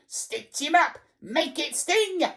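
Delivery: high, frenzied egging-on; piercing, excited goading; shrieking old harridan. A narrator's reading. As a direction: screech it with mounting, spiteful glee. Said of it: "shrieked Missus Pratchett".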